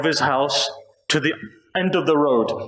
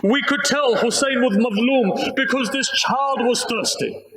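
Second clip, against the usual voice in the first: higher, pleading, grief-stricken recital; rising lament; 5 semitones higher than usual. Real, not acted. Preacher reaching the baby's death for mourners, higher and lamenting. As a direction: lift the voice as the grief builds. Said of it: "If I can recite".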